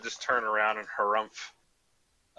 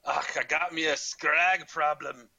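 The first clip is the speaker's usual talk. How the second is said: grumpy Scottish villager, pitched up; horrible Scottish accent; 4 semitones higher than usual. Acted. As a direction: grumble in a hammed-up Scottish accent. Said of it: "So back to my horrible Scottish accent".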